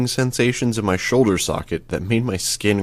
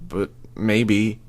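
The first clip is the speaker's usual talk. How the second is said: ordinary delivery, nothing marked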